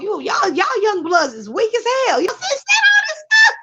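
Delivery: put-on high, shouting old man, scornful of the young; mocking old-timer. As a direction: yell that the young bloods are weak, cracking at the top.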